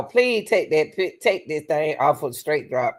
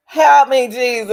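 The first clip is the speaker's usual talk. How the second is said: exasperated cry; 5 semitones higher, 9 dB louder than usual. Real, not acted. Mock despair at testimony.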